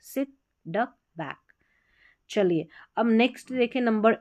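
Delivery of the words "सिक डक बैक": सिक, डक, बैक as separate soft short words; soft, unforced सिक डक बैक with short vowels; short, no pressure. Shown as a demonstration. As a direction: say सिक डक बैक lightly, pausing between. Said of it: "यहाँ पर इतना pressure की ज़रूरत नहीं".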